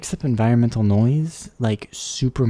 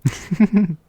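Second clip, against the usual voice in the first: short, high giggle burst; high burst of laughter; 8 semitones higher, 5 dB louder than usual. Real, not acted. Streamer laughing at her own Minecraft joke, high giggle.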